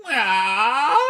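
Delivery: high, drawn-out meow; cat meow. Acted. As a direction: mew like a cat at the door. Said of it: "said the cat".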